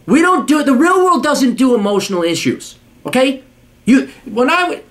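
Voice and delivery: high-pitched, exasperated scolding; angry rant. Real, not acted. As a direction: lay down the law in outrage. Angry rant at letter writer.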